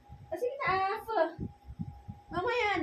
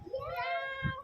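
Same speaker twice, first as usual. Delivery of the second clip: high squeaky meow, softer; 12 semitones higher, 4 dB quieter than usual. Shown as a demonstration. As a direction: meow like a tiny kitten. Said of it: "Paano yung meow?".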